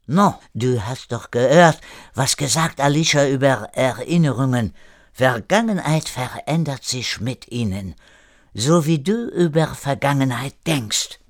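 put-on lively French-accented German; French-accented René. René instructing a hesitant Erik, French accent.

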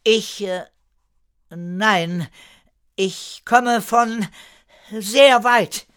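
high, slow, broken-up answer; hesitant. A narrator's reading. Newcomer avoids saying where he is from, hesitating. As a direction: search for each piece, then push out the end. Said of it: "richtete sich auf und zögerte".

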